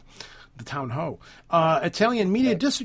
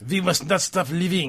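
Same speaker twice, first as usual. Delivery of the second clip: put-on French accent. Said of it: "I wish I could read that in a French accent".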